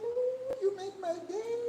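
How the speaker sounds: high, laughing, almost teary delight. Retold or imitated. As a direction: gush with happy tears. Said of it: "And I'm like".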